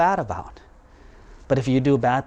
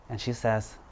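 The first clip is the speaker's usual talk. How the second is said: plain reading voice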